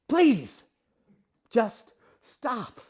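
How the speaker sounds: usual speaking voice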